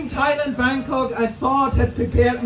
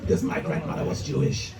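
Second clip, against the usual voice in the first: muttered aside; 10 semitones lower, 8 dB quieter than usual. Retold or imitated.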